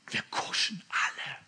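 hushed, confessional whisper. Acted. Preacher admits to the church that all cower, whispering. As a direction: breathe it like a shamed admission.

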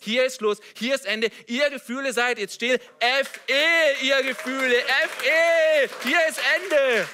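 high, loud orders building to a yell; full-force preacher's rebuke; commanding shout. Acted. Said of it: "spreche ich zu meinen Gefühlen und sage".

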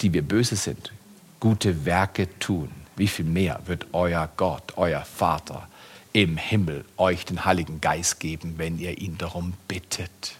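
low, soft scripture reading; hushed Bible quote. Acted. Preacher quoting the Bible to the church, soft and low. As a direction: draw them in quietly with the verse.

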